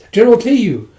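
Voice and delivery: high, loud call of the name. Acted. Gunte calls out to General Teiyu. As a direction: hail him from across the hall.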